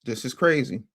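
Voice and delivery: ordinary talk, nothing marked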